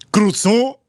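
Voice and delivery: high, rising French-style order; mock French accent. Customer insisting on his order, mock French accent. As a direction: push it up into a fake French accent.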